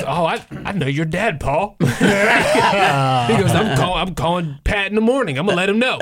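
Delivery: hammed-up old guy promising to tell dad; old guy threat.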